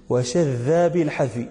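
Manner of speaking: slow measured reciting of وشذ بالحذف; verse recitation. Retold or imitated. Teacher quoting Ibn Malik's poem in a slow recitation. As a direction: intone the line of verse slowly. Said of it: "قال ابن مالك رحمه الله في لاميته".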